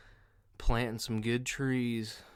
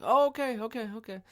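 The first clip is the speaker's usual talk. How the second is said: high-pitched exaggerated okay; hasty backing off; 13 semitones higher, 5 dB louder than usual. Acts out man backing down.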